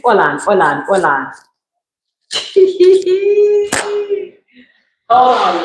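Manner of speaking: light Jamaican accent on "hold on". Shown as a demonstration. Warning her viewers to wait, in a light Jamaican accent. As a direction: slip into a Jamaican lilt for "Hold on, hold on". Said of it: "Let me get my Jamaican accent".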